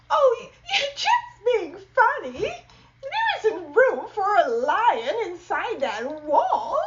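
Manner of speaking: high, laughing woman's voice; Missus Dow. Acted. Missus Dow laughing off her husband in a high voice. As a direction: giggle at his silly idea.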